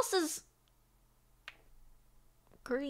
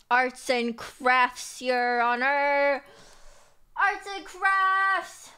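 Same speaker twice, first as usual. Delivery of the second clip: very slow, stretched words; slow drawn-out; much slower than usual. Pretend. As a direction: drag out each word slowly. Slow sing-song repeat.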